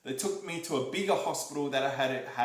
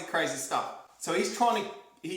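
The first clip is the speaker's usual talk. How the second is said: ordinary talk, nothing marked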